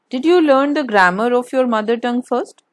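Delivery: ordinary talk, nothing marked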